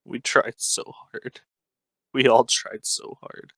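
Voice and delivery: hoarse, strained and quiet; raspy voice. Acted. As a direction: rasp it out, holding back tears. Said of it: "in a raspy voice".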